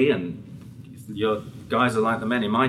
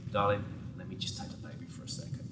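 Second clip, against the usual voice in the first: gentle to wife; 10 dB quieter, faster than usual. Retold or imitated. Husband gently addresses angry wife.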